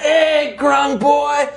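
loud, high mocking thug voice; skull-faced thugs heckling the grung, loud and high; thug taunt. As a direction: jeer at the little frog, shouting. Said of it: "they're like".

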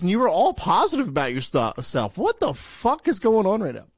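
loud, high-pitched disbelief; mock incredulous. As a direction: exclaim it like a baffled friend. Acts out Nate's incredulous outburst.

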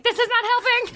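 high-pitched, whining voice; high whiny complaint. Retold or imitated. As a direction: whine it way up high. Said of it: "and I'm like".

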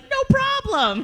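high, drawn-out mock "no problem" voice; carefree bravado. Storyteller mimics her friend Jason in the pond, high drawn-out bravado. Acting out Jason's confident reply.

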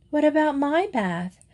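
high, lightly voiced small child; a hint of a little girl piping up. A narrator's reading. Small girl asking about her bath, lightly voiced little voice. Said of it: "asked a little voice".

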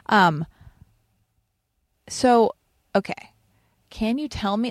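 ordinary talk

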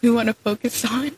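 lightly imitated struggle with English words. Host retelling a singer's awards speech, lightly imitating his struggle. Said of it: "he was stuttering so bad".

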